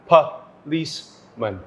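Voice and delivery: "policeman" broken into puh, leece, mun with pauses; slow, segmented "policeman" with schwa first and last; syllable by syllable. Shown as a demonstration. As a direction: slowly separate each syllable of "policeman". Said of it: "that is the correct pronunciation".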